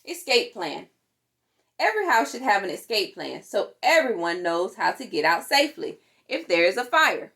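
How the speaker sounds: ordinary delivery, nothing marked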